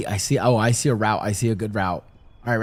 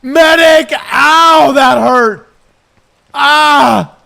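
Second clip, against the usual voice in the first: high pained shout for medic; man down shout; 6 semitones higher, 3 dB louder, much slower than usual. Acted. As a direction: scream for a medic.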